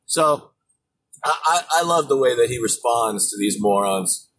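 ordinary talk

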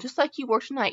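ordinary talk, nothing marked

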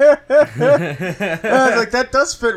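high, breaking into laughter; laughing. Real, not acted. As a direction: laugh through it, slowly. Laughing hard.

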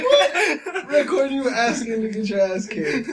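high, laughing disbelief. Real, not acted. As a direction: laugh through the words. Laughing retort.

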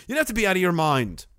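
ordinary delivery

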